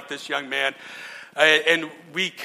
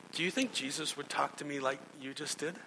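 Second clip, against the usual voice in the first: gentle question; 11 dB quieter than usual. Retold or imitated. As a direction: ask it quietly, without accusing. Pastor answers gently at funeral.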